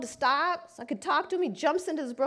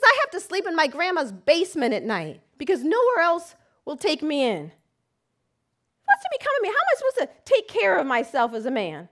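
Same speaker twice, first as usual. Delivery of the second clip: desperate outcry; 3 semitones higher, 6 dB louder than usual. Retold or imitated. Enraged client, desperate.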